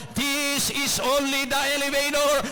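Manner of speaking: "This is only the elevator" shouted high, "elevator" stretched and ringing; shouted preaching. Shown as a demonstration. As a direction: roar "elevator" like a revelation. (isinisigaw na "This is only the elevator")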